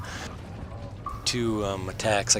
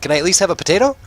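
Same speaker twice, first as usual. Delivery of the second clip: put-on high kid voice; 7 semitones higher, faster than usual. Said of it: "He's like".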